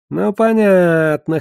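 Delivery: high, drawn-out, slow acknowledgement; knowing hmph. A narrator's reading. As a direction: hum it out knowingly, long and high. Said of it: "хмыкнул тавернщик". (хмыкая, высоко и протяжно)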